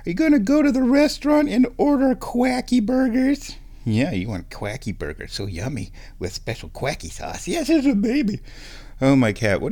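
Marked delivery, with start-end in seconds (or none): 0.1-3.5 high, cooing pet voice. Real, not acted. Asking the cat about dinner. 7.5-8.4 high cooing pet voice. Real, not acted. Cooing at the cat.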